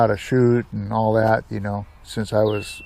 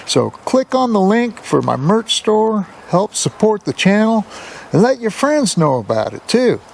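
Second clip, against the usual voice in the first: higher, upbeat YouTube host voice; bright higher announcer-style channel opener; channel intro read; 8 semitones higher than usual. Acted. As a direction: pitch it up like opening your own show. Channel intro and subscribe pitch.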